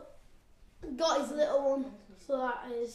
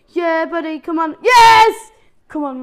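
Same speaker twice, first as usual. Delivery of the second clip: much louder, higher rooting shout; loud cheering; 4 semitones higher, 19 dB louder than usual. Real, not acted. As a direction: cheer it like a goal is coming. Cheering his cell on.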